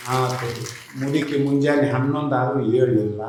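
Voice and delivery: ordinary delivery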